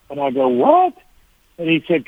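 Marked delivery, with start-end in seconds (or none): 0.5-0.9 a sudden, much higher startled "What?"; surprised what. Retold or imitated. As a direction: jump up in pitch, caught off guard. Retelling Chet calling his name.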